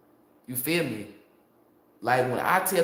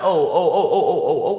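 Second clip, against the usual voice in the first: loud, cracking oh-oh-oh; mock realization; 8 dB louder than usual. Imitating people who finally get it, hammed-up wail. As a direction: ham up the dawning realization.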